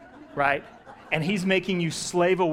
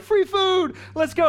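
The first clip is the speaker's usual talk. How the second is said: much higher, louder mock-eager cry; hammed-up hungry crowd rushing in; eager crowd cheer; 7 dB louder, slower than usual. Six hundred islanders heading to the feast, excited yell.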